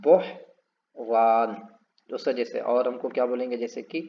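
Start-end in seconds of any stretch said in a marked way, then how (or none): 0.0-1.5 बहरान very slow with a long ओ held; drawn-out word. Shown as a demonstration. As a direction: stretch the ओ in बहरान. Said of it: "इसको हम बोलते हैं". (ओ खींचकर बहरान)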